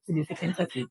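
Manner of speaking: normal talking voice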